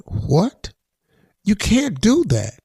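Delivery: mildly acted disbelief, pitch lifting in protest; a quick mock-baffled objection; Doug Christie incredulous. Retelling a dinner guest's reaction, mildly incredulous. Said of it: "was sitting there going".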